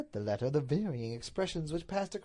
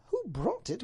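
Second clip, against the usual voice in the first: high lady's echo of a question; pitched-up indignant wife; Duchess's echo; 9 semitones higher than usual. Acted. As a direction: throw the question back incredulously. Duchess echoes him indignantly.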